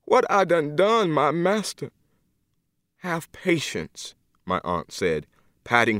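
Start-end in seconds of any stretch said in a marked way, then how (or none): 0.1-1.9 higher, tearful plea; crying lament. Acted. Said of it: "crying now". 3.0-4.2 higher, softer, very slow; gently calm her down; soothing. Acted. Aunt patting her shoulder.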